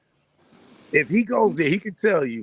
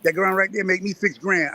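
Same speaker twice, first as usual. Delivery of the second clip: normal talking voice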